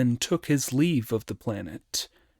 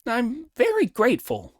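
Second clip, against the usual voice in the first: high, emphatic monkey thanks; Monkey, grateful; 12 semitones higher than usual. Acted. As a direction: pitch it high and stress "very". Monkey thanks the planet in character.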